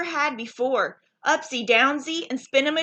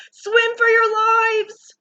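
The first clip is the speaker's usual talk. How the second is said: high, slow panicked yell; panicked shout; 11 semitones higher, 6 dB louder, slower than usual. A narrator's reading. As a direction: scream a warning. Panicked fish flee.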